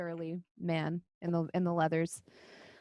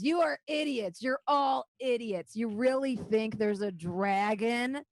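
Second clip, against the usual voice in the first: high-pitched, stretched-out scorn; exasperated outburst; 4 semitones higher, slower than usual. Acted. Brother berating the whole council, exasperated. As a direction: berate them all, dragging out each insult.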